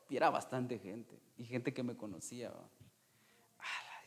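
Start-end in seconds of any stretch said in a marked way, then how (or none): 3.6-4.0 breathless unvoiced whisper; a stunned, barely voiced whisper; whispered ala.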